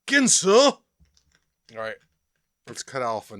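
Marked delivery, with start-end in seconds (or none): 0.1-0.6 high, loud showman cry; infomercial shout. Shown as a demonstration. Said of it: "I got my Ginsu knife". 0.6-0.8 loud, high showman cry; excited shout. Acted. Announcing knife with flourish.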